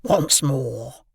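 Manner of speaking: hoarse threatening whisper; menacing whisper. A narrator's reading. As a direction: hiss it like a convict. Convict threatens the boy.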